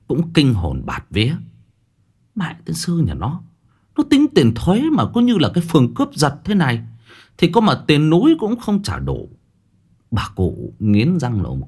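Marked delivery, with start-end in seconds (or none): 2.3-9.3 high-pitched, indignant wail; aghast outburst. A narrator's reading. Old woman appalled at the official's tax sum, high aghast complaint. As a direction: exclaim in horrified disbelief, voice pitched up.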